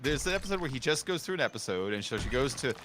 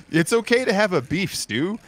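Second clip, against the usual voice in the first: loud, higher sitcom delivery; mock joke line; 7 semitones higher, 10 dB louder than usual. Streamer quoting the show's pun, loud sitcom voice. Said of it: "they'd say stuff like".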